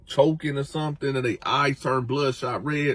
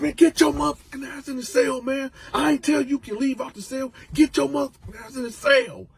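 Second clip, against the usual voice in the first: angry order; 11 semitones higher than usual. Retold or imitated. Freddy ordering Coco.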